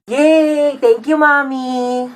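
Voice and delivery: put-on high kid cheer, slowing into drawn-out thanks; singsong thank-you to mommy; delighted child. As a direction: cheer like a spoiled little kid. Child gets the toy.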